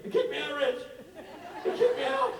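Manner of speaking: high, whiny distressed friend; whiny Rob. Retold or imitated. Mock-whining to his friend about being kicked out. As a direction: pitch way up and whine like a panicked buddy.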